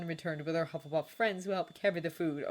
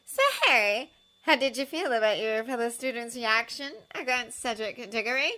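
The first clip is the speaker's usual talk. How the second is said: high, loud sugary reporter voice; Rita Skeeter; 6 semitones higher, 5 dB louder than usual. A narrator's reading. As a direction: lean in and coax the boy. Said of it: "Now she leaned toward Harry and said".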